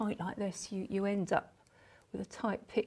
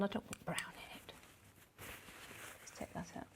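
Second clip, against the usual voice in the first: whispering; 2 semitones lower, 16 dB quieter, faster than usual. Real, not acted. Painter muttering to herself.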